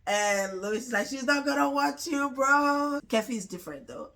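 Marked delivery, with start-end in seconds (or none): 1.1-3.0 high, drawn-out mock friend voice; raised pitch, teasing a hopeful buddy; teasing friend's retort. Friend lets [name] down about a girl, high teasing imitation.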